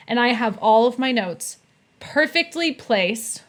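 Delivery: usual speaking voice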